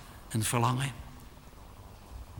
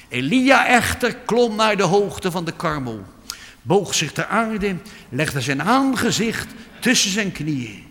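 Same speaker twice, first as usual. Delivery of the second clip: loud, high preaching that builds up; fiery preaching; 7 semitones higher, 7 dB louder than usual. Acted. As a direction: urge the congregation with full force. Preached narration of Elijah.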